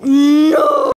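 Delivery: loud, high cry of dismay; real startled shout at the screen. Real, not acted. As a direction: yell no at the sudden fall. Falls again in game.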